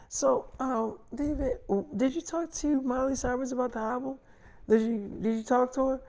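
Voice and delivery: imitating a cautious girlfriend, light and high; tentative question. Girlfriend cautiously raises touchy subject.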